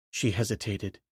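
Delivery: ordinary delivery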